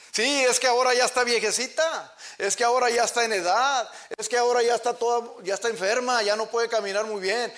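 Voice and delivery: high, loud, mocking whine of an ungrateful son; scornful complaining child. Preacher mocks a child calling his aged mother a burden, loud scornful whine. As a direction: shout it like a child fed up with his old mother. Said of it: "Así dicen unos hijos".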